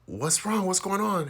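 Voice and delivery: high-pitched worried questioning; alarmed concern. Retold or imitated. Daughter asks mom who burst into tears, alarmed. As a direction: imitate an anxious child asking what happened.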